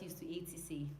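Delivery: whispered aside. Real, not acted. Vlogger whispering the topic to his viewers. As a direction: whisper it like a secret. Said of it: "whispered topic aside".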